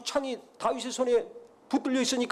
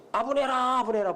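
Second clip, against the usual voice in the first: mock call, raised then dropping; retold summons, higher on the first call; 4 semitones higher than usual. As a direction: call a name twice, as if across a distance. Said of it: "불렀어요".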